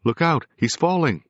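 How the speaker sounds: high, loud alarm; cried out. A narrator's reading. The medium crying a warning as the figure falls, loud. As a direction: warn the room urgently. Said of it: "Perry cried".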